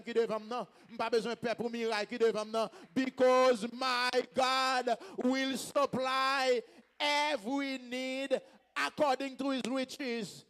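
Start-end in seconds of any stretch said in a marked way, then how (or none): 2.9-10.4 very slow, stretched preacher's declaration; ringing, elongated scripture proclamation; drawn-out proclamation. Real, not acted. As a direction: hold out each word of the verse. Proclaims the verse to congregation.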